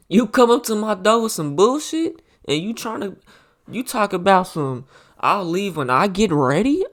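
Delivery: loud, high-pitched incredulous challenge; hammed-up indignant questioning; mock confrontation. Acts out confronting a visitor.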